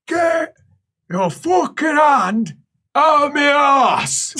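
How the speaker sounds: high, strained shout, drawn out slowly; acted angry yell. Patient yelling at whoever is treating him, acted outraged shout. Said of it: "you hear a deep-throated".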